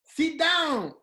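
"Sit down" shouted high and loud; exasperated shout to a confused listener; mock shouting. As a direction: yell it like an impatient parent. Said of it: "O quê?".

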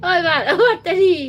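loud high call across the live chat. Real, not acted. Calling out to the viewer to talk. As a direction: shout the nickname to get attention.

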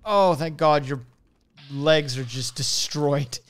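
normal talking voice

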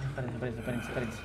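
whisper-urgent, hold on; hushed. Real, not acted. Gamer telling viewers to wait while hiding, hushed.

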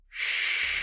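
soft unvoiced shush; whisper. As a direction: hush someone with a whispered shh. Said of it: "and then whispered".

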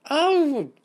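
sudden high, loud squeal; shrieked high "oh". A narrator's reading. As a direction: squeal as if tickled on the foot. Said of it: "she pulled her leg in and squealed".

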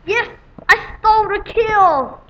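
crowing with delight, high and loud; gleeful boast. Real, not acted. Gamer gloating to viewers after stealing a kill, gleeful and high. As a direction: cheer the stolen kill out loud.

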